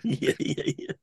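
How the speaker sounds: breathy laugh waving it off; laughing denial. Real, not acted. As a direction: laugh and deny it. Denies teasing, laughing.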